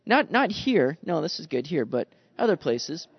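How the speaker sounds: usual speaking voice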